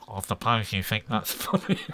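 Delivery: nasal elephant with nose held; pinched nose. Retold or imitated. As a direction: hold your nose, dry and nasal. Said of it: "he'd pinch his nose and go".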